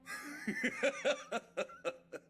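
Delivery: loud, high-pitched belly laugh; hearty laugh. Acted. Hearty sergeant greets gloomy patient.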